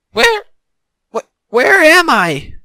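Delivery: high, loud, halting question; falter, then call it out slowly; bewildered stammer. A narrator's reading.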